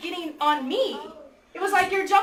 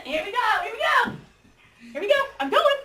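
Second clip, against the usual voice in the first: higher, excited acted chatter; eager and excited; 5 semitones higher, faster than usual. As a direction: act out someone raring to go. Acting out eager fishing partner wading in.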